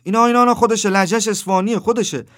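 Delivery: high, loud, urgent outburst; excited recognition. Acted. Taxi driver recognises the Isfahani youth by accent, excited. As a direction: blurt it out as if you've just spotted him.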